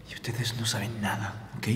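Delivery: whispered and slow; conspiratorial whisper. Acted. Father whispers to the girls to deny everything. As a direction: hush it so nobody else hears. Secret instruction to the women.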